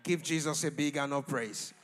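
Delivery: very loud, shouted blessing; booming prophetic declaration in short bursts; full-force preaching. Real, not acted.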